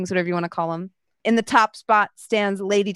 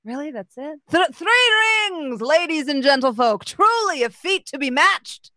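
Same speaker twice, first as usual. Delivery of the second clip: sarcastic announcer; 7 semitones higher than usual. Acted. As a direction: sneer at the score as announcer. Announcer mocks the score.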